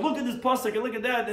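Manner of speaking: mock heated quarrel voice, high and loud; heated arguing. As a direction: act out two scholars jabbing at verses. Rabbis shoving over a dispute.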